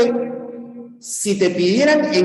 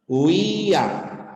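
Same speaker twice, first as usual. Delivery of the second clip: "Huía" as hu-ÍÍ-a, held I; stress on I. Shown as a demonstration. Teacher showing the special word to students, stressed Í in "Huía". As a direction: draw out the Í of "Huía", then a falling A. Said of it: "la cerrada lleva fuerza de voz".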